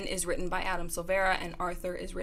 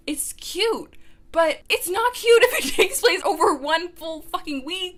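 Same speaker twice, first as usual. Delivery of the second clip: higher, louder, laughing exasperation; exasperated rant; 10 semitones higher, 8 dB louder than usual. Real, not acted. Ranting at the book's pacing, higher and louder. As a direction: throw up your hands at the timeline.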